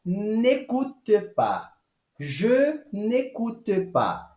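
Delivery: "Je n'écoute pas" slowly, elision nekut; careful French negation; slow French model. Shown as a demonstration. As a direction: say it slowly for students. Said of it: "a primeira frase fica".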